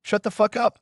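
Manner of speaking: sharp high yell. Acted. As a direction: snap at her to shut up. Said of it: "yelled Irika".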